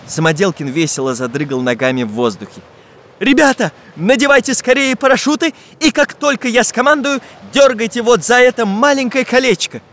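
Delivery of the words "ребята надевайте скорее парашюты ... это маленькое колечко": high-pitched, urgent directing; commanding instructions. A narrator's reading. Iron master directs the parachute jump, issuing urgent orders. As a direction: give orders to the team. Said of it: "руководил операцией железный мастер".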